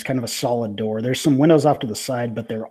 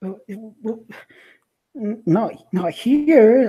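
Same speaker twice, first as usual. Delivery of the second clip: flustered woman voice; 9 semitones higher, 4 dB louder than usual. Acted.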